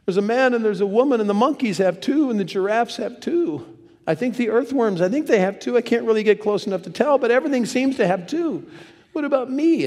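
high-pitched plaintive Adam; complaining Adam. Acted. Adam comes back to God without a mate, plaintive. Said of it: "Adam comes back".

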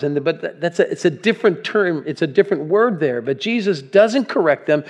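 ordinary talk, nothing marked

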